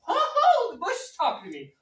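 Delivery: sudden high, loud yelp of surprise; startled Moses. Retold or imitated. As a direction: jump at the talking bush. Moses startled by talking bush.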